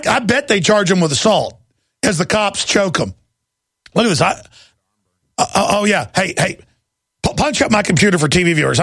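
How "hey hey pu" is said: ordinary talk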